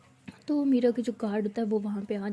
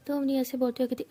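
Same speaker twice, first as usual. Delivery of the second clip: plain reading voice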